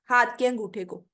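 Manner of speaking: usual speaking voice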